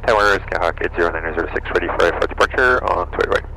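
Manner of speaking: ordinary delivery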